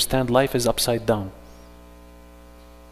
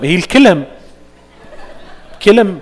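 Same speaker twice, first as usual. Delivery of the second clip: usual speaking voice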